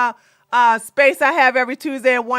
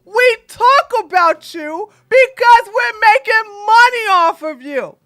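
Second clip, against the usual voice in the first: high, loud fake crying; mock wailing; 6 semitones higher, 3 dB louder than usual. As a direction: wail in fake distress.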